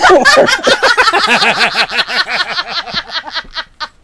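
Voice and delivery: long squealing laughter; can't stop laughing; laughing hard at the memory; long high laugh. Real, not acted. Laughing mid story.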